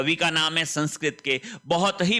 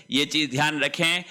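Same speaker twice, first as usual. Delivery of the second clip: normal talking voice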